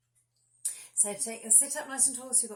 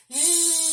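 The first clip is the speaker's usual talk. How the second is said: loud buzzing zhuzh, pitched up; big zhuzh; 6 semitones higher, 11 dB louder than usual. Shown as a demonstration. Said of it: "we're going to do a big zhuzh like this".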